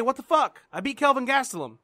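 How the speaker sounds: ordinary talk, nothing marked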